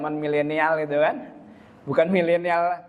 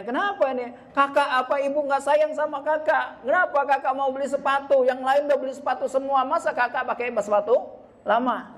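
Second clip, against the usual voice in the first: put-on high, whining kid voice, up in pitch; petulant child pleading with mom; whiny child; 8 semitones higher than usual. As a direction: mimic a sulking kid begging for new shoes.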